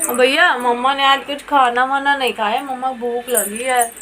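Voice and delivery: put-on child's plea. Child voiced asking for food.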